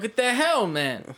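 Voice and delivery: a retold burst of surprise; mock exasperation. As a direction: blurt it out as the figures topple. Figures knocked over, retold outburst.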